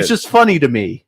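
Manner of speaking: high put-on killer voice; mock hilarity. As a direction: imitate a killer amused by his own prank. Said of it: "he just starts laughing hilarious".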